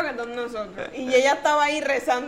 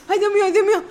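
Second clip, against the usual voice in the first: imitating mom's frantic prayer, higher and louder; mock panic; 7 semitones higher, 6 dB louder, much faster than usual. Mom during the earthquake, higher mock panic. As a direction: fret like a startled mother.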